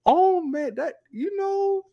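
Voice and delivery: giddy with disbelief; giddy inner voice. Retold or imitated. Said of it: "I'm in my head, I'm like".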